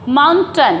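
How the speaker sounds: माउंटेन said as "MAUN-tun"; short weak ending on माउंटेन; word demo. Shown as a demonstration. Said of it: "क्या बोलते हैं इसको".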